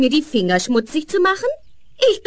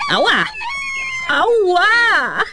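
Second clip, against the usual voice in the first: put-on girl pricking her finger; ouch cries; 10 semitones higher than usual. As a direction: yelp from the prick. Girl pricks herself with thorn.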